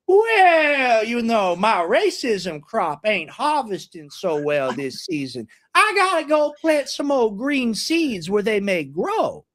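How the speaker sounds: slow, drawn-out Southern plantation owner; hammed-up slow Southern drawl; plantation owner accent. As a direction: stretch the words like a smug Southern landowner. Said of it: "the plantation owner accent".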